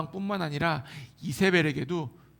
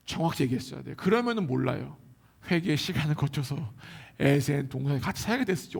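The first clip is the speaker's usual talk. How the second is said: ordinary talk, nothing marked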